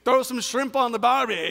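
hammed-up Down Under accent; mocking antipodean accent. American visitor teasing a New Zealander, mocking Down Under accent. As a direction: tease him with a mock Aussie-Kiwi twang. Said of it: "mocking him".

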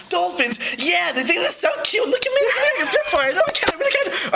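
high squeaky mock dolphin; sneer, then squeak like a show-off dolphin. Shark mocking the dolphins, in a high squeaky mock voice.

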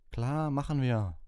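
ordinary delivery, nothing marked

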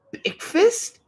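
put-on high little-girl question; a tiny girl asking for food; toddler voice. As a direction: ask it like an eager toddler. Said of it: "said Boots".

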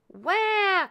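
put-on high, loud hungry-baby cry; baby's wail. A narrator's reading. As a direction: wail for a bottle. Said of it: "cried baby ghost".

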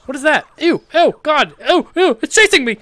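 loud panicked shrieks, high; scared yelling. Real, not acted. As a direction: yelp in real fright at the creature. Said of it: "that was scary".

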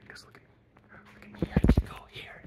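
breathy whisper, toying with the camera; playful whisper. Real, not acted. Showing off to the viewers, whispering playfully. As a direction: hush it like a secret game.

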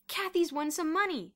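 high, loud announcement; excited exclamation. A narrator's reading. Bruno telling Boots about Kathy's prize, excited exclamation. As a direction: exclaim the good news. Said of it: "Bruno exclaimed".

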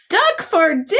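shocked gasp. Acted. Reading to preschoolers, a high shocked question about dinner. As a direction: gasp it as if horrified.

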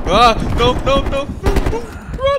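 panicked. Real, not acted. Caught by hunter.

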